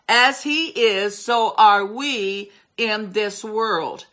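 slow, weighty quotation; slow solemn scripture quote; slow emphatic scripture. Real, not acted. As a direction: lay each word down deliberately. Preacher slowly quoting scripture.

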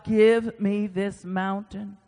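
ordinary talk

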